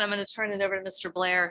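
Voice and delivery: ordinary talk, nothing marked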